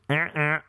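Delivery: short two-note honk; vocal car-horn imitation; car horn noise. Shown as a demonstration.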